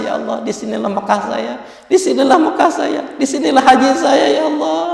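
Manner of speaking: high, pleading retold lament; imitated tearful cry. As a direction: voice a weeping man's prayer, pitch climbing. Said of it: "Dia menangis". (menirukan ratapan doa sambil menangis)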